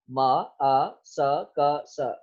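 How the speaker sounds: म अ स said very slowly; sound by sound. Shown as a demonstration. Teacher splits mask into sounds, very slow. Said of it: "तो इस तरह से mask".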